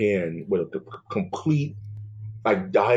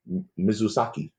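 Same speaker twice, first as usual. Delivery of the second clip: usual speaking voice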